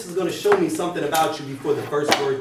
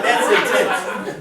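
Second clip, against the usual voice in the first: slow, higher, louder through a laugh; 6 semitones higher, 5 dB louder, much slower than usual. Real, not acted. To the class, amused by the intense stare. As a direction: drag it out amused.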